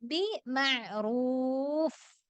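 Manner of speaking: ബിമഅറൂഫ് slowly, letting air escape on the final ഫ്; slow recitation with an airy ഫ് at the end; breathy final fa. Shown as a demonstration. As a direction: stretch ബിമഅറൂഫ് and release a breathy ഫ്. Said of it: "സിഫ അതിന് കാണിക്കണം".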